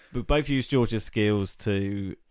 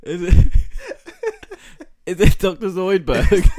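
laughing while guessing; 8 semitones higher, 10 dB louder than usual. Real, not acted.

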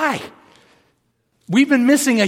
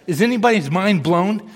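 normal talking voice

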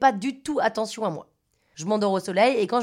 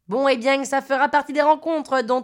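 higher, louder Marseille man; pitched-up southern French, Marseille style; imitated Marseille accent; 4 semitones higher, 5 dB louder than usual. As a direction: put on a loud Marseille accent.

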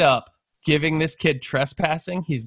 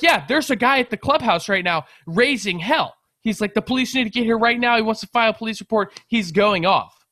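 high, loud breathless-news retelling; imitated worked-up onlooker; excited gossip; 9 semitones higher, 5 dB louder than usual. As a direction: relay the uproar like an eager witness. Onlookers excitedly report the uproar.

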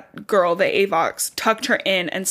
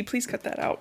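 whispered plea; 3 semitones higher, 10 dB quieter than usual. Real, not acted. Begging friend to cut recording.

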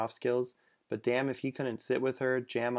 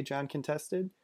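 plain reading voice

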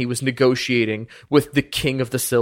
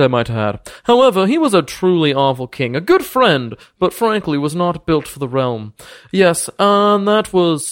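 higher, pompous noble voice, suddenly gracious; boastful lord Thaddeus; 5 semitones higher than usual. Acted. Lord welcomes the party after the deception, pompous and higher. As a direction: play a smug ancient lord welcoming guests.

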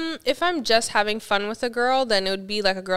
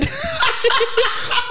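loud laugh; 3 dB louder than usual. Real, not acted. Laughing at own impression.

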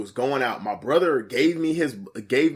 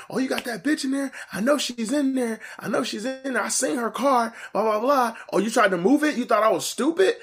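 high-pitched mock yell at ordinary volume; imitated yelling; 10 semitones higher than usual. Ex-wife accusing him from outside, mildly imitated yelling. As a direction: mimic her accusing rant, voice pitched up. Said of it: "she came and she was yelling".

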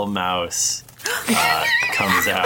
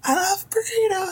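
squeaky, very high mouse voice; tiny mouse voice. Acted.

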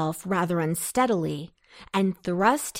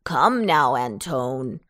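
persuasive; 6 semitones lower than usual. A narrator's reading. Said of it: "in a persuasive voice".

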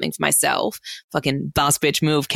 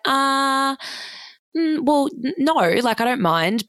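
awkward hesitation; 10 semitones higher than usual. Retold or imitated. Hesitant reply to boyfriend.

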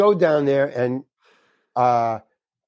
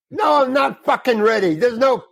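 frustrated outburst; 9 semitones higher than usual. Retold or imitated. Nervous actor blurting at the casting lady, frustrated. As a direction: blurt it out, fed up with the wait.

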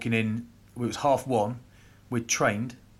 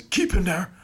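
Irish Godfather voice, high and urgent; Wally; 12 semitones higher, 4 dB louder than usual. Retold or imitated. Hungover prop pleading with young teammate, hammed-up Irish Godfather. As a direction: order it in a mock Irish voice, rising louder and higher. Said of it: "sound like the Irish Godfather".

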